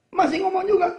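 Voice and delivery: loud high exasperated cry; exasperated outburst. Acted. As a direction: burst out fed up. Mock exasperation at nagging. (seruan kesal keras dan tinggi)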